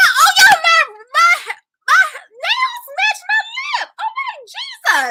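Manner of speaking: very high, loud squeal about the nails; excited shout. Real, not acted. Host showing viewers her nails match her lip, squealing with excitement. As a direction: gush at full pitch over the match.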